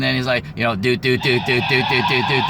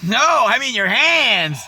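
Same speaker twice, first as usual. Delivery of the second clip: playing the fussy guy; 6 semitones higher than usual. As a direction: correct her primly. Guy explains he meant hands.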